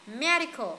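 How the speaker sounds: "medical" with weight on ME; stress on first syllable. Shown as a demonstration. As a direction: lean on the start of medical. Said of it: "Âm đầu nhá".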